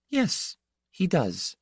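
put-on high, slow, gentle girl voice; gentle daughter.

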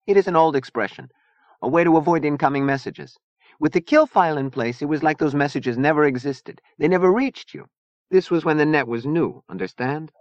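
put-on high, accented storytelling voice; fiery Zona, explaining. A narrator's reading. Zona explaining kill files to Chia, instructive. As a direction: explain it step by step.

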